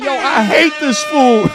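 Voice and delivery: slow, loud mock rival voice; mock O'Shea voice. Streamer imitating a rival donor, a slow loud mocking voice. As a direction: lightly voice the rival hating on you. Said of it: "O'Shea is like".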